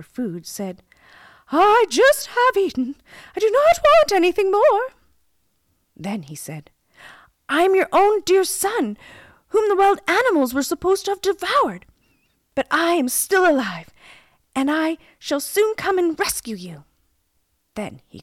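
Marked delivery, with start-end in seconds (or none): 1.5-4.9 very high, loud queenly voice; storybook queen refusing from her tower; imprisoned queen. A narrator's reading. Imprisoned queen calls back. 7.5-16.8 high, loud declaration to his mother; impassioned storybook son promising rescue; prince calling out. A narrator's reading.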